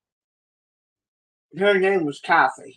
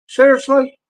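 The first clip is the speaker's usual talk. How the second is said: incredulous; 9 semitones higher, 5 dB louder than usual. Retold or imitated. Disbelief at Kathy's menthol trick.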